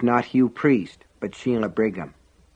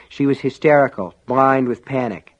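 ordinary delivery, nothing marked

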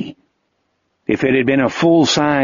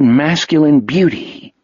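plain reading voice

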